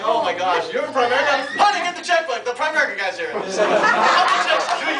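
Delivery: loud, high, hammed-up excitement; mock gushing. Thrilled client greeting the Primerica agent, mock gushing.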